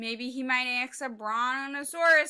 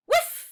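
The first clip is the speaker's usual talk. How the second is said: sound effect; 8 dB louder than usual. Acted. Sound effect of ping pong.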